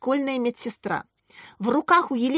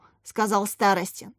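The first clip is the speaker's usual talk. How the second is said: usual speaking voice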